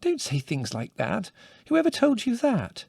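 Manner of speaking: slow then shooting high, scandalised; shocked motherly scolding; aghast mother. A narrator's reading. Mother hearing her son mention sex, aghast. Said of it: "said Mother, aghast".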